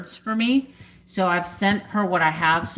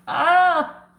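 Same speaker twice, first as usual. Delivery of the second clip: emotional gasp; 11 semitones higher, 6 dB louder than usual. Real, not acted. Overcome with emotion on stream.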